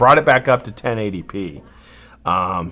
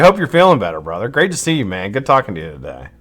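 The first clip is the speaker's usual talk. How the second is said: ordinary delivery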